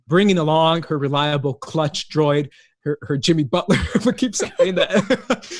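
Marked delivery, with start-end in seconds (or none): 3.9-5.5 slow laughing aside. Real, not acted. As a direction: chuckle through it. Laughing at own joke.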